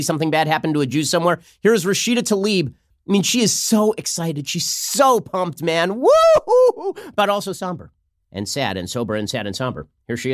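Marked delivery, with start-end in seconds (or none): 3.2-6.9 drawn-out hype into high whoop; hammed-up celebration, stretching "so"; mock excitement. Mocking Tlaib's gleeful excitement. 7.2-9.9 mock somber. Mock switch from glee to solemnity.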